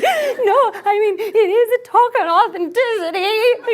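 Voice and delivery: high, slow, sobbing protest through tears; weeping and half laughing, high and slow; crying. Retold or imitated. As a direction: choke the words out between sobs. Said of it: "animalistic groans".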